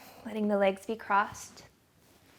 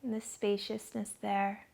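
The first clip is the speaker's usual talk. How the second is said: guided meditation; 6 dB quieter than usual. Acted. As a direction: ease them into calm, dropping to a whisper on the breath.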